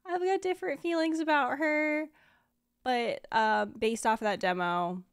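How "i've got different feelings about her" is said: higher, louder voice quoting own earlier doubts; mock your earlier self in a high, raised voice; retold doubts. Streamer quoting their doubts before the demo, high mock-unsure voice. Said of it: "I was like".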